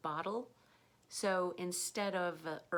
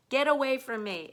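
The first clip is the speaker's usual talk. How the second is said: higher, louder, laughing get away from me; playful loud demo; 7 semitones higher, 11 dB louder than usual. Shown as a demonstration. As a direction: toss it out like a joking shove. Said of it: "If you're saying it fast".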